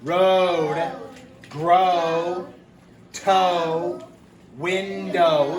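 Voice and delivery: each word called out loud and clear; teacher reading aloud. Shown as a demonstration. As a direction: read each word out like a model for the class. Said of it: "I'm gonna read these".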